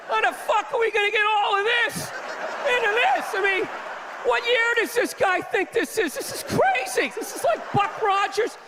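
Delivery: high, frantic disbelief; a scientist freaking out at the job; mock panicked scientist. Panicking scientists in back room.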